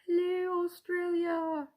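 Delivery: put-on a high, failed stab at an Australian accent greeting Australia; attempted Australian accent. Greeting the Australian winner in a mock Australian accent. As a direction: tries an Australian accent and gives up. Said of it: "I can't do the accent".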